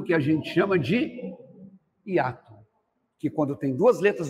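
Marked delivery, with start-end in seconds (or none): none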